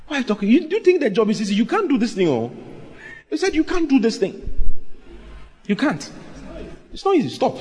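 high-pitched, agitated protest; imitated discouragers. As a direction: mimic alarmed friends talking him out of it. Acts people discouraging him from pastoring.

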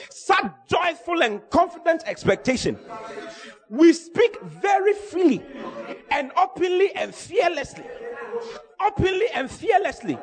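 high, stretched, declamatory sermon; full-force preaching. Real, not acted. Preacher urging church to speak freely and fearlessly, full-force preaching. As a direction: proclaim it high, with spaced-out phrases.

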